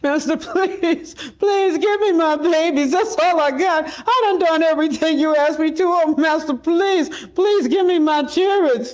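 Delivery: a mother's raw plea to the master who sold her babies; anguished begging. Acted. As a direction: beg for your children with everything left.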